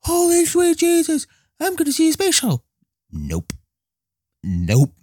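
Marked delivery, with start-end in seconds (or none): 0.0-2.6 very high, fast, giddy squeal; giddy excitement. Retold or imitated. Past self heading to the museum, squealing with excitement. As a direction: squeal like a kid before a treat. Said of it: "I was so excited to go there".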